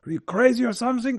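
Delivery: high-pitched imitation of a German dad; German accent. Son imitating his German dad's reproach, in a German accent. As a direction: scold lightly in a put-on German accent. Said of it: "He has a German accent".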